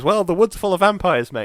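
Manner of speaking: put-on tossed off like old news, mate; matter-of-fact local. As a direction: local shrugging off the danger, lightly voiced. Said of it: "He goes".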